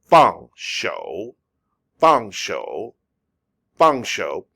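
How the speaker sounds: "Feng" struck sharply downward, then "shou" dipping and rising; angry fourth tone. Shown as a demonstration. Teacher modelling the phrase, angry falling "Feng" before a dipping "shou". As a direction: say "Feng" like you're angry, let "shou" dip. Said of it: "I say it like I'm angry".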